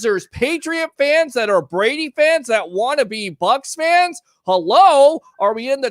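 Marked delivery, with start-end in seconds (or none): 4.4-5.2 incredulous hello. Real, not acted. Host mocking complaining fans.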